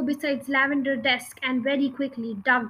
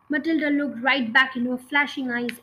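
ordinary delivery, nothing marked

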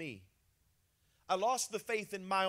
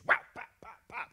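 spanking sounds; 3 semitones higher than usual. Retold or imitated.

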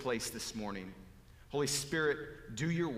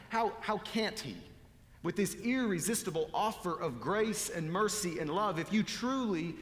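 ordinary delivery

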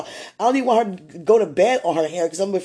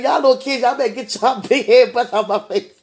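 loud, higher, amused telling-off; mock scolding; 5 semitones higher, 5 dB louder than usual. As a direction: scold the kids, half laughing. Retelling her scolding of kids.

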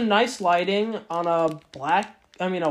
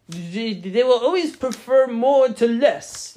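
put-on German accent; 3 semitones higher, 4 dB louder, slower than usual. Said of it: "I can't fucking do a German, Austra-, whatever, wherever he's from, accent".

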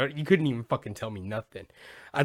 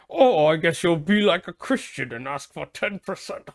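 higher, put-on snooty atheist voice; condescending atheist; 4 semitones higher than usual. Podcaster plays atheists mocking Christians, condescending. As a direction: imitate a smug know-it-all sneering at believers. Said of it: "I'm sure they all talk like this".

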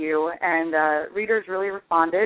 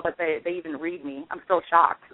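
ordinary talk

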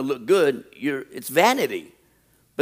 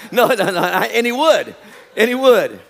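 higher, louder laughing protest; 5 semitones higher, 6 dB louder than usual. Real, not acted. Preacher joking with congregation after teasing someone, laughing. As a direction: laugh through the words, pitched up.

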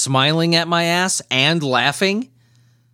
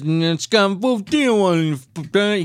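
slow, mumbled mock Louisiana drawl; lightly imitating a Louisiana speaker; mock Louisiana accent; 5 semitones higher, much slower than usual. Host mocking how Louisiana people talk, light Louisiana imitation. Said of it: "everybody talks like this".

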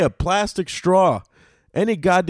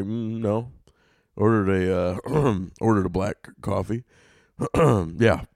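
lower, softer cover-up with throat clearing; embarrassed pretending; 7 semitones lower, 4 dB quieter than usual. Customer too embarrassed to admit his drink, low sheepish denial. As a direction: deny it low and awkwardly, clearing your throat. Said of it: "now I gotta pretend like I didn't order that".

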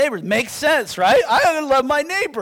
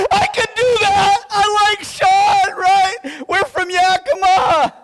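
laughing enthusiasm; 7 semitones higher, 4 dB louder than usual. Real, not acted.

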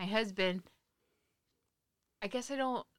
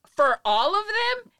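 high, loud, slow disbelief; shocked; 12 semitones higher, 11 dB louder, slower than usual. Retold or imitated. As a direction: gasp it out in disbelief. Retelling shock at vase price.

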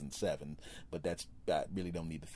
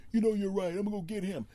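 high, loud, mock-eager husband voice; convinced husband; 12 semitones higher, 5 dB louder, faster than usual. Husband sold on the idea, high and loud. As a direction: play him as fired up to go get the guy.

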